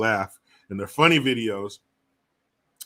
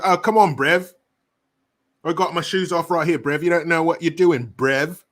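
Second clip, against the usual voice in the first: put-on higher, pushy young British street accent; 5 semitones higher, 5 dB louder than usual. Retelling comedy video dudes protesting a search, imitated British accent. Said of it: "But their accent".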